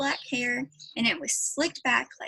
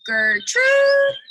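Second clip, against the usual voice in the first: shrill, loud parrot squawk; parrot screech; 14 semitones higher, 10 dB louder than usual. Acted. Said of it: "the parrot screamed again".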